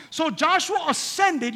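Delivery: slow, high proclaiming; emphatic reading. Acted. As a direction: stretch every word out.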